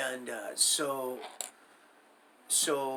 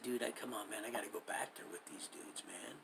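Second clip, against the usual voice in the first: retold whisper; 4 semitones lower, 12 dB quieter than usual. Retold plea to Bear, dreading cell.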